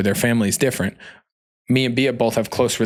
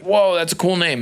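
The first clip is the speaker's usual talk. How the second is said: mock impressed; 7 semitones higher than usual. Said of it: "somebody's like".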